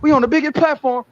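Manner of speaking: squeaky mock impression of the team's spin; mocking Bud's team. Mocking Bud's team to the stream, in a high put-on voice. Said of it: "like his team say".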